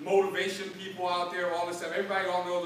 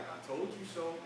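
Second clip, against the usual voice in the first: smug told-you-so; 4 semitones lower, 11 dB quieter than usual. Retold or imitated. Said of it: "They be like".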